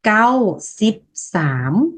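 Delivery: Lao number spelled out slowly for learners; each syllable laid out slowly; slow model reading. Shown as a demonstration. Teacher models the Lao number slowly for students. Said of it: "chín mươi ba là".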